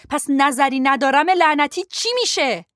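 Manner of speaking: loud, high, biting on نظری ندارم; angry retort. Acted. Lo mocking Ben's excuse with anger, loud. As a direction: throw his words back at him. Lo snapping back at Ben.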